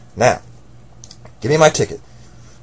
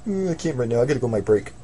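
usual speaking voice

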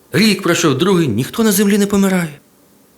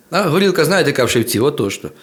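usual speaking voice

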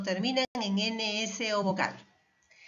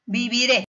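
Viviré louder, peaking on RÉ; stress on last syllable; 7 dB louder than usual. Shown as a demonstration. Teacher giving an aguda example, Viviré stressed on RÉ. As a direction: hit the final RÉ of Viviré. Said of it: "Miren esas en qué terminan".

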